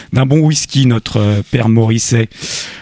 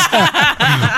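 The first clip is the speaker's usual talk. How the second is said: high laugh. Real, not acted. Laughing at the [name] joke.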